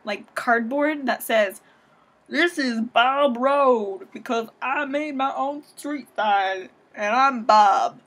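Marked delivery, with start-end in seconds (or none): 2.3-8.0 slow, hammed-up Southern drawl; mock Southern accent. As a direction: imitate a proud local in a broad, slow Southern accent. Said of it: "anybody who has a Southern accent".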